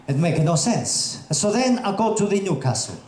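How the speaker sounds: normal talking voice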